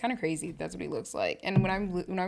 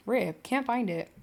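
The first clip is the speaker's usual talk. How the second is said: normal talking voice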